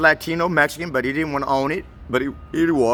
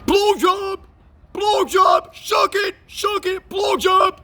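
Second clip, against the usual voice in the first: crude shouted catcall; 4 dB louder, much slower than usual. Retold or imitated. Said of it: "looking at me like".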